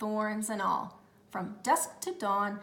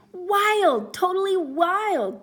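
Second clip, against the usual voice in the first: high, loud, slow cheer; 9 semitones higher, 10 dB louder, slower than usual. Acted. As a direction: rave like a thrilled agent. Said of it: "cheered Mister Lamb".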